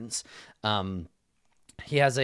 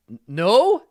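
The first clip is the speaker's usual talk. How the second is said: sheepish denial; 8 semitones higher, 8 dB louder than usual. Acted. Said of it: "he's like".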